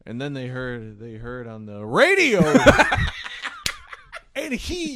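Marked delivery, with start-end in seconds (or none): 0.0-1.8 low, hesitant storytelling; halting low lead-up; low-energy old singer. Retold or imitated. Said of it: "like, low energy". 1.8-2.4 abrupt, drawn-out holler; sudden yell. Retold or imitated. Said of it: "yelled radio".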